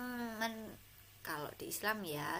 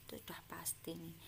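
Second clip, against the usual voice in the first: fast whispered aside; hushed quick aside to pupils; 4 semitones lower, 10 dB quieter, much faster than usual. Real, not acted. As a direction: whisper it quickly as a sure fact. Teacher aside on question marks.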